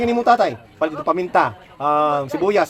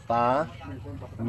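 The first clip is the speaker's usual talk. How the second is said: Paminta drawn out slowly and softer; slow soft repeat; 7 semitones lower, 9 dB quieter, much slower than usual. Shown as a demonstration. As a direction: stretch Paminta gently as a list item. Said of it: "palithin paminta". (mahina at mabagal na Paminta)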